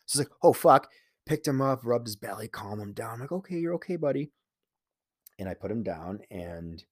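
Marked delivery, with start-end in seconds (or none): 0.4-0.9 sudden loud alarmed oath, louder; startled curse. Retold or imitated. Owner startled by his dog's yelp, loud alarmed curse. Dog yelps in pain suddenly. 3.3-4.3 higher, softer, gentle reassurance; calm a frightened pet; soothing the dog. Retold or imitated. Owner comforting his injured dog, soft and reassuring.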